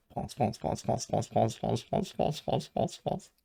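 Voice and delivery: one word repeated in an even, steady rhythm; monotonous chant-like roll call, climbing late; rattled-off repetition. Shown as a demonstration. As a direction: tick them off like a long list, rising at the end. Reading down a leaderboard full of French players.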